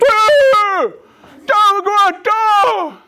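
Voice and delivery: high, loud quarterback's shout, half unintelligible; mouthpiece-muffled quarterback yell. Acted. Quarterback calling the play to his linemen, muffled shouting. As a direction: bellow high through a mouthpiece. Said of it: "he got a mouthpiece you don't really understand".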